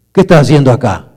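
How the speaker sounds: father shouting at his son who showed up; angry father. Retold or imitated. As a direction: bark it at the boy, furious. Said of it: "me empezó a gritar, enojado".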